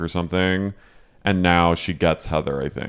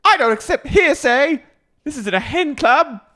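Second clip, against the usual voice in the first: very high, loud British indignation; hammed-up, haughty British refusal; mock British; 4 dB louder than usual. Co-host playing Meredith refusing gossip, high mock British accent. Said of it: "goes back into a British accent".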